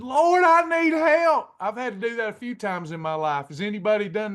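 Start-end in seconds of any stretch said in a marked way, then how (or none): 0.0-1.5 higher, louder, slow cry for help; desperate prayer. Retold or imitated. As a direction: plead with God, voice straining upward. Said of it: "And I started praying".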